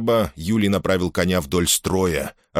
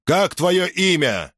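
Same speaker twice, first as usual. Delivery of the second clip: angry demand; 11 semitones higher, slower than usual. Acted. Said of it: "проревел Юлий, багровея от гнева".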